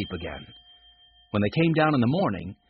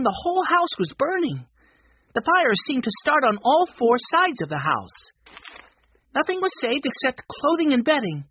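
put-on high child's voice reading the news; young Benny's high-pitched reading voice; excited boy reading aloud; 9 semitones higher, 4 dB louder than usual. A narrator's reading. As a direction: read out the dramatic report, high and eager. Reads the newspaper aloud as Benny.

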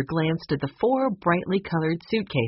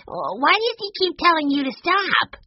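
put-on very high-pitched little boy protest; upset child's cry; 13 semitones higher, 3 dB louder than usual. A narrator's reading. Benny baffled by the telegram, crying out. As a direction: wail the question like a puzzled child. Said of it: "Benny cried".

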